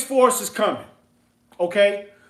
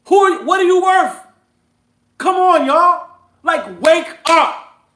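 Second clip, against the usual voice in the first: loud and higher, pushing hard at the crowd; heated yelling rant; 6 semitones higher, 5 dB louder than usual. Real, not acted. Host yelling at his listeners to quit retail. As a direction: preach it at full force, fed up. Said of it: "I ain't trying to yell at y'all".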